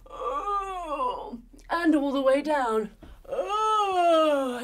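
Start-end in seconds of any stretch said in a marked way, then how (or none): 0.1-1.4 long drawn-out stretch noise; stretching groan. A narrator's reading. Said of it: "The dingo does a big stretch up". 3.3-4.6 long, sliding stretch noise; stretching groan. A narrator's reading. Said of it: "The dingo does a big stretch".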